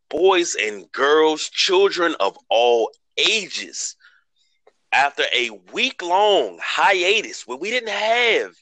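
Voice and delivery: slow, drawn-out ringmaster announcement. Acted. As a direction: hype the crowd like a ringmaster. Ringmaster-style team introduction.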